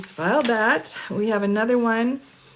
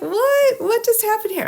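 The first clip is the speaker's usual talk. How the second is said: much higher, louder startled what; shocked disbelief; 4 dB louder than usual. Retold or imitated. Commentator reacting to the filing, shocked squeak. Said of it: "I'm like".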